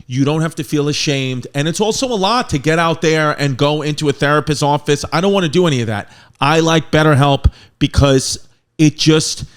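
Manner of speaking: loud, punchy sponsor read. Acted. Host reading a BetterHelp sponsor spot to listeners, loud ad read. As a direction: sell it straight to the listeners, loud.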